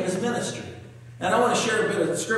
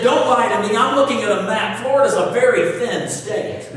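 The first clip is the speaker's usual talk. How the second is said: loud indignant insistence; louder, mock outrage at the agent; angry customer; 3 semitones higher, 6 dB louder than usual.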